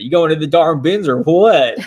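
playful exaggerated country accent while laughing; hammed-up country accent. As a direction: ham up a rural Southern country twang. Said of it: "I got kinda country when I said that".

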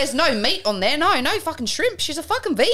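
high, indignant Australian; hammed-up high Australian accent. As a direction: protest like an outraged Aussie. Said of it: "I can only do Australian".

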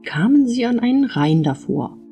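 ordinary delivery

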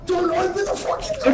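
high, loud frantic shout; panicked yell. Real, not acted. Panic at the door.

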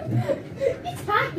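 high laugh through words. Real, not acted. Laughing at pepper eater.

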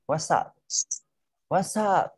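"WhatsApp" as wa-sap, high on sap; linked-sound demo. Shown as a demonstration. Teacher modelling the link for a student, high-pitched demo. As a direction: lift the linked second syllable of "WhatsApp" high. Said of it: "sờ thành sắp".

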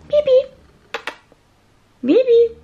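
high, loud call to a pet; calling the dog. Real, not acted. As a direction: call the dog from across the room.

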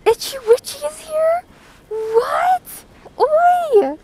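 very high, loud squeal of surprise; surprised excited shout. Real, not acted. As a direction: shriek with delighted disbelief. Streamer surprised by returning viewer.

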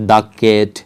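the cat, slow, "the" as "daa". Shown as a demonstration. Teacher recapping examples for learners, "daa cat". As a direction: open "the" to "daa" before cat. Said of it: "এটার ক্ষেত্রে কী ছিল?".

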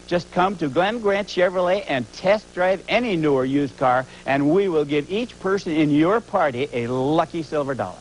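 loud hard-sell shout. Acted. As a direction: yell the pitch at the crowd. Shouted ad pitch.

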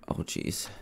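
breathless whisper, spooked; a scared little whisper to himself; whispered oh jeez. Real, not acted. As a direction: whisper it under your breath. Spooked in dark elevator.

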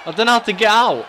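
high, loud panicked yell. Real, not acted. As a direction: yell it out, trapped and desperate. Trapped in submission.

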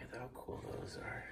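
whispering. Real, not acted. Dad filming up close.

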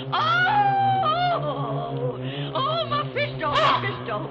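high, loud cheering on of the bird; frantic urging. Acted. Keeper urging Mephisto to pluck the Whir's eyes, frantic cheering. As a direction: egg on the bird in a frenzy.

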